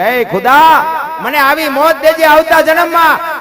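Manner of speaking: a king's high, loud plea to heaven; loud fervent prayer. Acted. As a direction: call out to God with longing. Sikandar praying to God.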